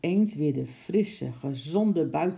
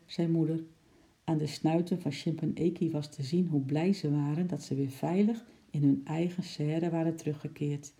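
ordinary delivery

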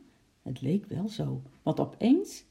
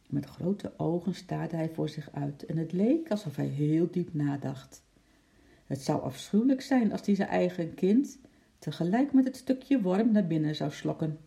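ordinary delivery

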